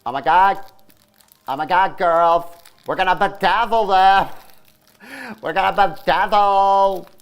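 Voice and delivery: loud, high-pitched silly excited voice; stupid voice. Pretend. Joking to the girls about bedazzling, stupid excited voice. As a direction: squeal it in a stupid, hammed-up voice.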